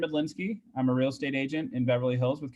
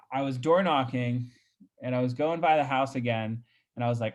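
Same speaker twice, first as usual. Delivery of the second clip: ordinary talk, nothing marked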